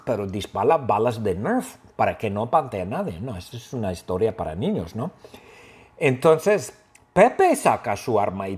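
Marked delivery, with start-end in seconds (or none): none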